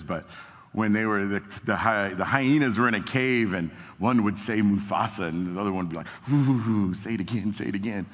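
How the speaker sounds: ordinary talk, nothing marked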